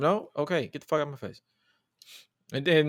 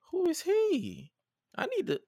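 smitten sister; 4 dB quieter, slower than usual. Retold or imitated.

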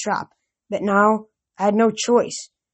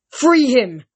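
loud, higher curt command; commanding order; 5 semitones higher, 6 dB louder than usual. A narrator's reading. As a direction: bark the order at the hero. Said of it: "Luke ordered".

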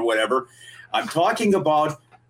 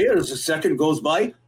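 usual speaking voice

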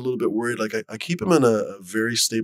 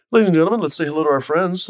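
announcer; 8 semitones higher, 7 dB louder than usual. Real, not acted. Host announces guests on stream.

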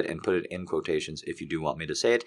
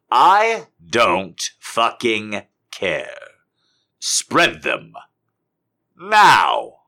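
louder commanding bark ending in a shout; louder building command; dominant command; 11 dB louder, much slower than usual. Acted. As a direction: order them firmly. Commanding dom quote.

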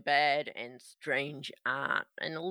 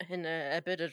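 ordinary delivery

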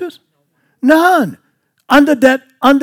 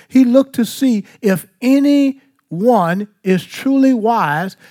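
normal talking voice

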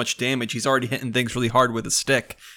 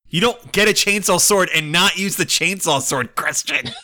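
laughing; 7 semitones higher, 3 dB louder than usual. Real, not acted. Laughing about chainsaw sword.

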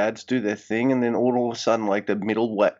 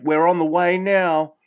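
higher and louder cheerful announcement; upbeat host; 7 semitones higher, 5 dB louder than usual. Retold or imitated. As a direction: call it to the camera brightly. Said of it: "He's like".

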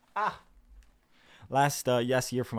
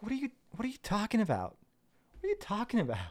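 high, baffled protest; mock incredulity; 11 semitones higher, faster than usual. Replying to people calling him ignorant, high mock bafflement. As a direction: ask it in a high, disbelieving voice.

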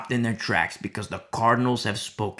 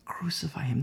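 much softer, almost whispered crowd cry; whispered imitation of crying out; hushed mock outcry; 9 dB quieter than usual. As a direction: act out a crowd's cry under your breath. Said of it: "So they cried out again".

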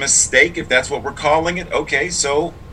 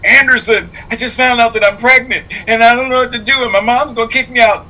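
mock tearful plea; 11 semitones higher, 4 dB louder than usual. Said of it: "tears in her eyes".

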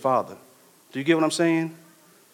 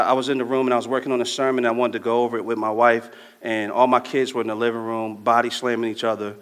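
ordinary talk

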